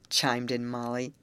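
usual speaking voice

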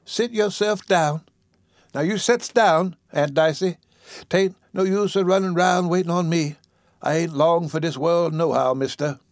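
put-on high-pitched ailing old lady; weak voice. Sick old woman seating her visitor, weakly. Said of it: "said the weak voice".

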